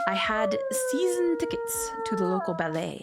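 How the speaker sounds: high, haughty society-lady voice; grand lady. Acted. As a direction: put on airs like a ballet patron. Wife boasts about her ballet.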